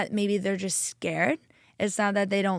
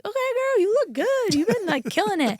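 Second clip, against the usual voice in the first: sing-song pep talk to yourself, much higher; self hype-up; 12 semitones higher than usual. Acted. Woman cheering herself on in her own head, playful high hype-up.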